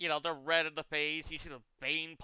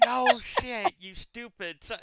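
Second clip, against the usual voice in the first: angry retort; 7 semitones higher, 8 dB louder, slower than usual. Retold or imitated. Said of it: "going off on me".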